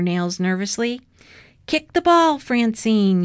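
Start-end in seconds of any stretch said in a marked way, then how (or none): 1.7-3.2 high, loud sideline yell. A narrator's reading. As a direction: yell urgently to the player. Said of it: "yelled Missus Frensky".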